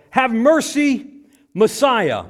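a loud high demanding shout; shouted command. Shown as a demonstration. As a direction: order him to show mercy. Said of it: "Not as a request, but as a command".

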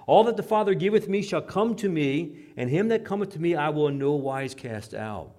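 full-voiced preaching; loud proclamation. Shown as a demonstration. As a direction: proclaim it to the room. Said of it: "first loud reading of verse".

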